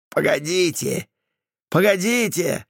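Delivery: high, mocking shout; mocking yell. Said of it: "проорал шакальник и хохотнул". (насмешливый крик)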